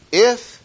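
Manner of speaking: "If" said high and loud, held before the rest; stress on if. Shown as a demonstration. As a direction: hit "If" hard, then pause. Preacher stressing the condition.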